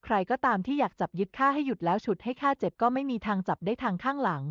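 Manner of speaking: usual speaking voice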